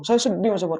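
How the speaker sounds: mildly plaintive, as if confessing through tears; retold tearful confession. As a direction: voice a touch of mock sorrow. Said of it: "Nangis beliau".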